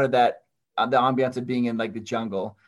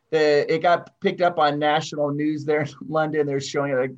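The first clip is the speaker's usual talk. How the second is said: ordinary talk, nothing marked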